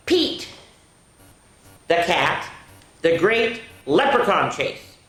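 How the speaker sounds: loud, drawn-out title; title announcement. Shown as a demonstration. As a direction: announce the book grandly. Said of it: "announcing the next book".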